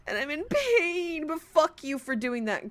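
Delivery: high fake sob; mock crying. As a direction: whimper it out, hammed-up.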